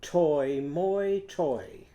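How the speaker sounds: sing-song the oi syllables of tomato; playful "Toy, moi, toy" demo; oi in each syllable. Shown as a demonstration. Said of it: "Can you say that?".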